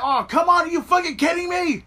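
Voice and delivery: high, loud re-enacted protest; reliving a fan's exasperated yell at the screen; outraged disbelief. Fan recalling his reaction to the kick-out, outraged disbelief. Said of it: "even I was like".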